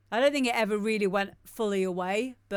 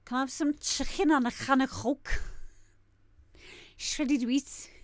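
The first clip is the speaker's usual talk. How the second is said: higher, put-on Scouse accent; jokey mock Liverpool accent; 5 semitones higher than usual. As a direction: order like a broad Liverpool local. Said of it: "that was my Liverpool accent".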